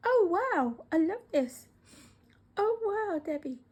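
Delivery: high, loud burst of delight; bowled over by a present; delighted gasping praise. Real, not acted. Delighted at a gift being unwrapped.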